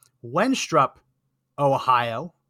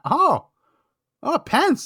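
surprised; 13 semitones higher, 4 dB louder, much slower than usual. Real, not acted. Spots Pence on the list.

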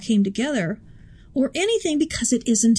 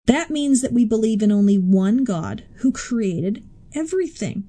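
normal talking voice

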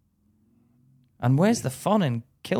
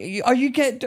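ordinary talk

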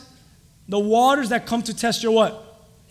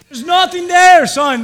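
firm dad; 6 semitones higher, 9 dB louder than usual. Retold or imitated. Father reassuring scared son.

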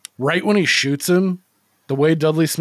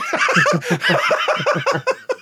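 high burst of real laughter; laughing; 9 semitones higher, 3 dB louder than usual. Real, not acted. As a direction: crack up at the impression. Laughing at the boyo impression.

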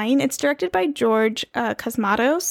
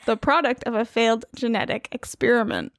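usual speaking voice

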